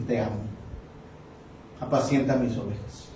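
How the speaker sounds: soft tender quote. Acted. Jesus answers Peter softly.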